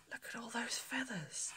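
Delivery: soft wondering whisper and gasp; awed whisper. Real, not acted. Vicar showing children the peacock feathers, awed whisper.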